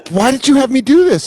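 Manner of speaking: high-pitched aggrieved whine; hammed-up high protest; mock complaint. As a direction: plead up high, as if betrayed. Acted complaint to friend who booked him.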